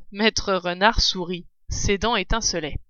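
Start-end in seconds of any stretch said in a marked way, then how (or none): none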